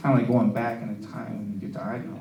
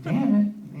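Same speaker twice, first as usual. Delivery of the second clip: raised-pitch mock exclamation; lightly acted cry of self-annoyance; frustrated blurt; 8 semitones higher, 3 dB louder than usual. Coworker after each outburst, mildly frustrated blurt. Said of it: "every time he says, like".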